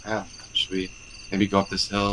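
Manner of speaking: soft, unsure, hedging question; timid hesitant voice. Retold or imitated. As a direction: ask it meekly. Hesitant leader asking timidly.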